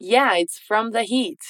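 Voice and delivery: slow, falling whole sentence; slow model line. Shown as a demonstration. Teacher models the full answer slowly. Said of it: "Agora tudo junto".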